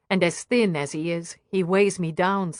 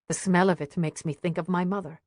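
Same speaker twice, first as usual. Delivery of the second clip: ordinary delivery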